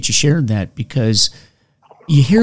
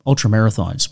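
ordinary delivery

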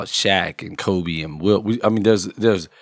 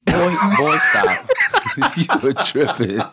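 laughing; 7 semitones higher, slower than usual. Real, not acted. Laughing at friend's claim.

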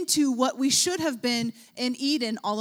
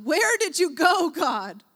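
crying out; 5 semitones higher, 5 dB louder, slower than usual. Pretend. Preacher acting a cry out to God. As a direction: voice rising in a desperate cry. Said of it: "I'm crying out".

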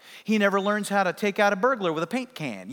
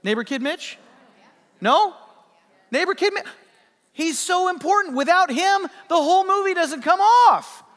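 mock indignation; 10 semitones higher, 3 dB louder than usual. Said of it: "mock indignant about Mitch".

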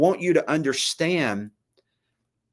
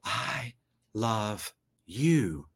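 hushed, slow, much softer voice; God's whisper; 3 semitones lower, 7 dB quieter, much slower than usual. Acted. Preacher voices God whispering love to listeners, hushed. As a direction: say it low and soft, word by word. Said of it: "have you ever heard God whisper".

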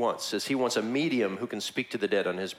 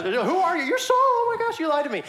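much higher, louder panicked squeal; frantic witch; 5 dB louder than usual. Retold or imitated. Witch realizes the visitor is Saul, high frantic squeal. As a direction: shriek it as the trick falls apart.

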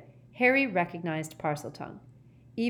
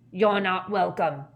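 louder, a touch of menace; lightly voiced hostile wild man; menacing stranger; 6 dB louder than usual. Wild man warning off Ogden, menacing.

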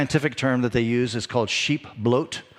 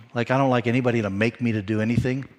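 usual speaking voice

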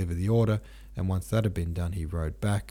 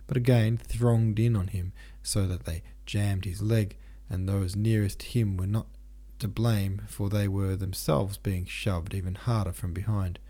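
ordinary delivery

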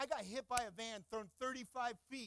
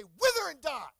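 shouted command; 4 semitones higher, 13 dB louder than usual. Acted. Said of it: "you look at it and you say".

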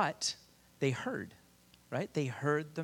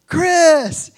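high, very loud drawn-out name; calling back; 17 dB louder than usual. Retold or imitated. Preacher greeting his party buddy, loud surprised call. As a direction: holler back to an old buddy.

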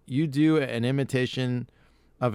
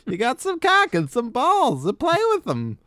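much higher, louder mock mother; lunch lady voice; 13 semitones higher, 4 dB louder than usual. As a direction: coo like a creepy doting mom. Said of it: "why would this woman talk to her kids".

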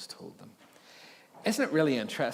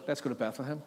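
a bland, matter-of-fact "Let's go to Bethlehem"; mock how casually they say it; deadpan shepherds; 3 dB quieter, faster than usual. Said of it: "and then they just go".

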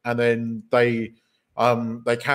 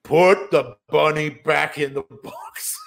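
quoting Cameron Poe; 3 semitones higher, slower than usual. Retold or imitated. Podcast host quoting the film's villain, mock menacing shout. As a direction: ham up the bunny threat. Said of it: "Cameron says".

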